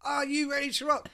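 high, full-on MC yell; big stage shout, demanding a response; shouting to crowd. Acted. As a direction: yell it out at a rock audience. MC repeats louder to crowd.

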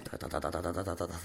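low, soft rapid ta-ta buzz; tattoo machine noise. Retold or imitated. Teller mimics tattoo gun at work, soft rhythmic tapping. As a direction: mimic the needle going.

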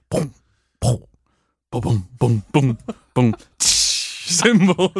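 vocal keyboard drum pads, short booms; imitated drum hits. Pupil pressing keyboard noise buttons, drum imitation. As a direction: punch out single drum sounds with the mouth. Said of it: "Various drum".

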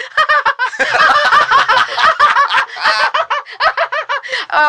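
high, loud, drawn-out laughing fit; nervous flirty laugh. Retold or imitated. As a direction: laugh far too long and too high. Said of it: "I was like".

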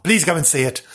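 ordinary delivery

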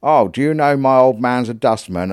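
put-on old boy voice. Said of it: "he said".

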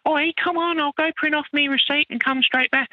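very high falsetto manager impression; mock duty manager. Duty manager coaxing the chef to start dinner, mock high falsetto. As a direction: wheedle in a put-on squeaky voice. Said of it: "She's like".

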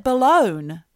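BALONE, balloon said to rhyme with bone; loud, drawn-out BALONE with a long O; mock mispronunciation. Shown as a demonstration. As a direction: stretch the oh in BALONE. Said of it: "why isn't it balone".

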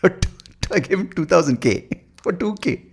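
high, slow mock accent, breaking into laughter; mock American-tinged accent. Mocking Indians abroad, high put-on accent through laughter. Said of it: "who say".

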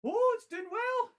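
very high delighted squeal; excited squeal. Real, not acted. Squeals at the jar reacting.

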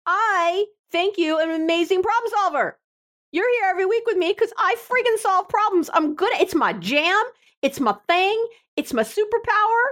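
high, over-the-top self-praise; mock boasting. Problem solver bragging to her listeners, high mock boast. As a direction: brag in a high, indignant pitch.

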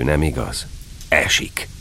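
plain reading voice

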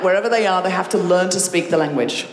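normal talking voice

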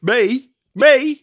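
high, loud indignant me; rising loud protest, twice; surprised. Real, not acted. Indignant at being called ADHD.

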